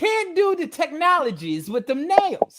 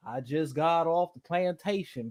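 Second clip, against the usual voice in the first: mock Southern plantation accent; 8 semitones lower, 7 dB quieter than usual. Man teasing a woman about her Southern accent, mock plantation voice. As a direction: imitate a rural Southern accent, pitched down and soft. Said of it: "that accent that gives off that ... voice".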